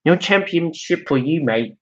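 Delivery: botched British sliding into Australian; accidental Australian accent. Acted. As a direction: attempt British and land on bad Australian. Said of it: "I guess that's Australian".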